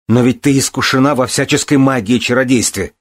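high, heated objection; furious protest. A narrator's reading. Son challenging the healer's powers, furiously. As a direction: protest hotly. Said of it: "яростно протестовал Балин".

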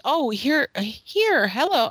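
mock introduction. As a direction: pretend to show someone off.

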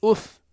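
أف with a single, short light f; light uff. Shown as a demonstration. Teacher to class, demonstrating أف said lightly. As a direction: say أف with an unstressed short f. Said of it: "خفيفة".

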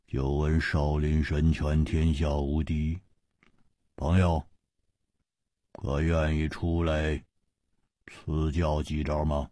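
low, slow, eerie menace; villain challenging the Shaolin disciple, low and eerie; sinister, gloomy voice. Acted. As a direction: speak darkly, like a threat. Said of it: "阴恻恻地说".

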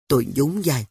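usual speaking voice

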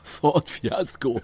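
slow, laughing through the joke; amused wordplay, slowed down; laughing pun. Real, not acted. Joke about the rental car.

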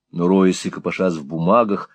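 usual speaking voice

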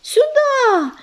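high breathless shout. A narrator's reading. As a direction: call it out urgently. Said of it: "выкрикнул".

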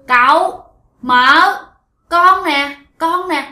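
put-on high, loud little child calling out; a small ghost child calling to its uncle and aunt; possessed woman as child. Dead boy's ghost calls uncle and aunt, childlike and loud. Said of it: "bả cứ như một cái đứa con nít".

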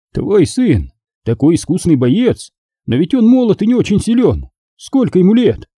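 high-pitched, incredulous knight master; astonished magister. Acted. Magister questioning a father about his young son, incredulous. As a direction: voice a worried old friend, pitched well up.

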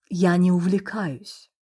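slow, gently reproachful correction; a light reproachful tone; mild reproach. A narrator's reading. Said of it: "с лёгким упрёком поправила".

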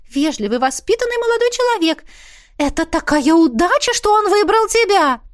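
high, excited chatty mother; mother gushing. Acted. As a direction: gush like a thrilled mother. Mother praising Kevin. (восторженная мама, высокий голос)

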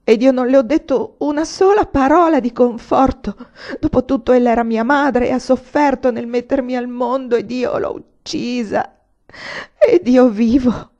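loud, high lament, slowing to a wail; anguished remorse. A narrator's reading. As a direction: accuse yourself in tearful anguish. Son's remorse over dead mother.

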